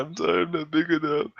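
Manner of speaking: high-pitched mock weeping voice; imitated tearful apology. Crying drunk apologizes to girl, high mock tearful whine. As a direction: whimper it like a sorry drunk. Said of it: "he starts crying".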